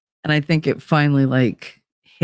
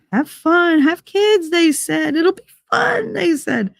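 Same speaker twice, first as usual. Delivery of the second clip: much higher, mocking sing-song; 12 semitones higher, slower than usual. Shown as a demonstration. Mocking the advice to have kids, much higher sing-song. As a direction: sing it out in sarcasm. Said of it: "I did that to my son".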